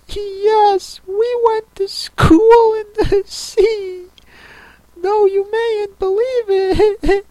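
very high, slow, tearful warble; mournful Mock Turtle. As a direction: wail slowly like a weepy turtle.

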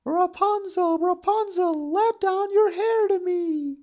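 high witch voice, calling up the tower; enchantress calling. Acted. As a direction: call up to the window like an old enchantress. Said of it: "he heard how she cried".

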